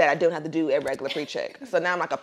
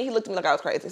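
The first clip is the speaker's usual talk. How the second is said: normal talking voice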